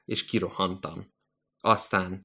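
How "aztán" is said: plain reading voice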